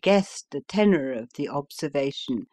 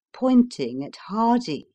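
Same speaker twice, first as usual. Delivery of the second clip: ordinary delivery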